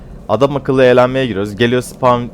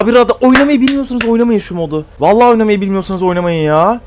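exasperated scolding; 9 semitones higher than usual. Real, not acted. Scolding players who can't use radar, exasperated. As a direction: tell them off for not knowing the mode.

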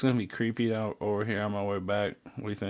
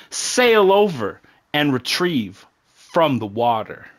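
loud, slow notice reading; 3 semitones higher, 7 dB louder, slower than usual. Acted. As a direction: read it out like a camp announcement. Reads the camp notice aloud.

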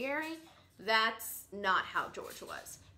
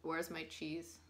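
lightly voiced unbothered parrot, lower and softer; George, put-together parrot; 4 semitones lower, 6 dB quieter than usual. A parrot asking for food while the world ends, mildly unbothered. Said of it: "he was definitely more put together".

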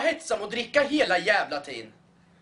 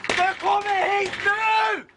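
slow, loud, high demand; drunken yell at the bus; shout; 10 semitones higher, 3 dB louder, much slower than usual. Acted. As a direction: drag it out as a furious order. Yelling at the bus.

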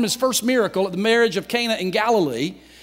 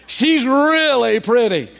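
"really" stretched out with relish; emphatic; 2 semitones higher, 4 dB louder, slower than usual. Retold or imitated. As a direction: draw out "really" slowly for emphasis. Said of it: "a way that really makes it emphatic".

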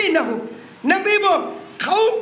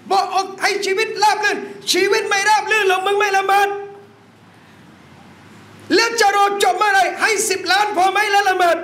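rising, emphatic sermon cadence; fiery preaching; 5 semitones higher than usual. Acted. Preacher urging the brothers about blessed wealth, emphatic. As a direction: drive each point home to the congregation.

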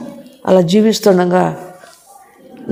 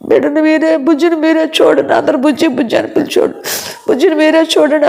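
tearful plea; 7 semitones higher than usual. Retold or imitated. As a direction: plead as if these are your last words. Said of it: "అందరు కలిసి గళ్ళుమని ఏడ్చారు".

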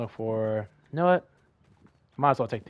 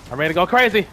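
ordinary delivery

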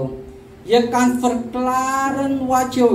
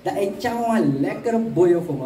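ordinary delivery

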